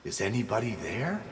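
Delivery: timid soft call into a dark cave; softest voice. Acted. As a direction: ask it barely above a breath into the cave. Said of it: "in his softest voice".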